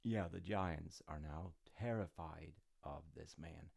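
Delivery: ordinary delivery, nothing marked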